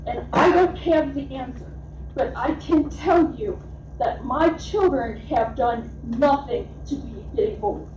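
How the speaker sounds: ordinary delivery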